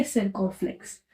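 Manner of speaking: usual speaking voice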